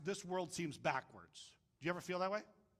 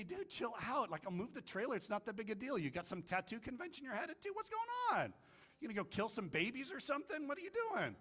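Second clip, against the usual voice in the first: mock exasperated retort; 5 semitones higher, 4 dB quieter than usual. What he wanted to tell the dude, mock exasperation. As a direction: protest incredulously, pitch leaping up on the questions.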